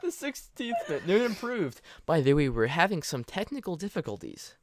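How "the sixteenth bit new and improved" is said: laughing. Real, not acted. Laughing at the title.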